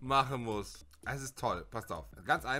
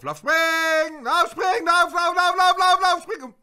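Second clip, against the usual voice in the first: high, loud, frantic commands; frantic yelling; 13 semitones higher, 11 dB louder than usual. Real, not acted. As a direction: scream the chicken forward. Brüllt das Huhn durchs Level. (schreit das Huhn laut und hoch an)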